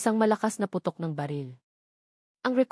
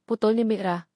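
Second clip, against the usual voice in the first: ordinary delivery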